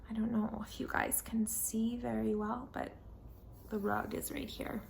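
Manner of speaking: soft whispered aside. Real, not acted. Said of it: "whispered aside to audience".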